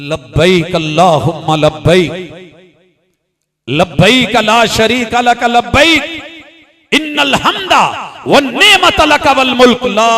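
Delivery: loud, drawn-out Arabic proclamation, climbing; full-force talbiya recitation. Real, not acted. Preacher demonstrates the pilgrims' shared call, loud chanted proclamation. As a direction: call it out like pilgrims answering Allah.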